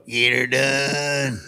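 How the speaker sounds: slow, drawn-out Get Her Done; his own catchphrase. Retold or imitated. Comic proves he's Larry, slow and drawn out. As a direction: stretch each word of the catchphrase. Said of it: "Let me hear you say Get Her Done".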